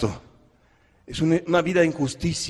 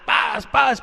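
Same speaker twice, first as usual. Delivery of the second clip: mock slap sounds; 5 semitones higher than usual. Preacher mimics slaps on child.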